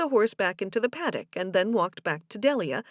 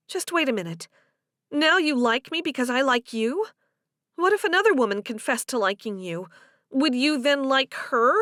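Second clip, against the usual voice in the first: higher, insistent woman's questioning; challenging Delia; 4 semitones higher, 4 dB louder than usual. Acted. Delia challenges Joe's reason for liking her, insistent. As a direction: press him with question after question.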